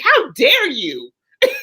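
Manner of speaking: high-pitched mock outrage; scandalised, pitch climbing, a laugh breaking in; retold flip-out. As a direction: act out your indignant blow-up for laughs. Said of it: "'Cause I flipped out".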